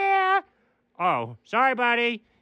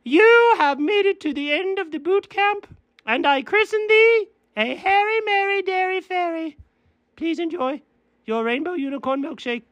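grand fairy voice; 11 semitones higher than usual. Acted. Fairy christens them.

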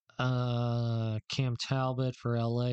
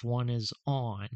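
usual speaking voice

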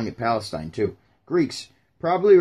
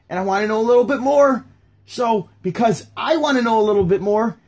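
higher, insistent pleading questions; worked-up, raised-pitch protest; eager crowd member; 8 semitones higher, 5 dB louder than usual. Pretend. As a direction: play a frustrated follower pestering for answers. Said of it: "If I was in the crowd".

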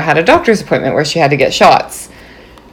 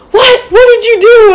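much higher, sharp startled What; husband caught off guard, much higher and louder; retold startled husband; 4 dB louder than usual. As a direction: imitate his alarmed double-take. Husband notices wrong turn.

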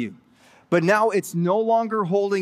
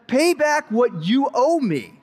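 higher, slow, insistent mock demand; 7 semitones higher, 3 dB louder, slower than usual. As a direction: lean on him like a creditor choking a debtor. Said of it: "he demanded".

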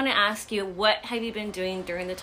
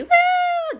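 high, loud cheer; cheering woo; 11 dB louder than usual. Real, not acted. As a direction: whoop for the guest. Cheering for guest.

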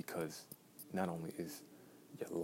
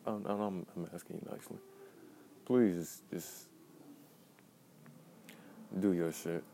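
calm turning angry. Real, not acted. Pleading builds to loud demand.

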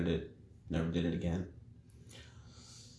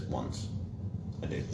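sheepish confession; 10 semitones lower, 10 dB quieter than usual. Acted. Confessing man in joke.

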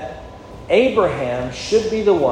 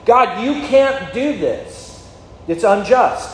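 slow, higher protesting plea to God; imitating Abraham's plea; 6 semitones higher, slower than usual. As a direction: plead with God, stretching it out.

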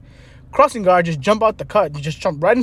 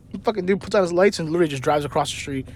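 usual speaking voice

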